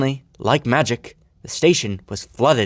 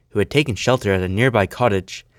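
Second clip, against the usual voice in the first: plain reading voice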